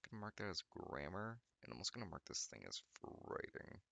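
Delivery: ordinary talk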